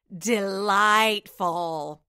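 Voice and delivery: loud, very slow, pompous declaration; declaring governor. Acted. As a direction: savour the word grandly. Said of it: "declared the governor".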